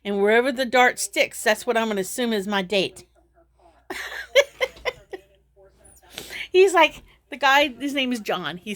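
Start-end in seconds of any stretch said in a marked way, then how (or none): 3.9-4.9 high, breathy giggle; short run of high giggles; amused laugh. Real, not acted. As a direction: laugh helplessly at your own joke. Laughs at her own dart plan.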